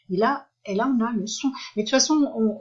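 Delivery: usual speaking voice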